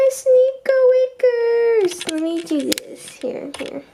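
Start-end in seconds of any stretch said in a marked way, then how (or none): 0.1-2.1 high, slow sing-song pet name; cooing pet name. Real, not acted. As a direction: coo the name to the kitten.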